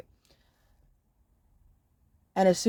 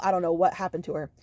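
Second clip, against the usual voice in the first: usual speaking voice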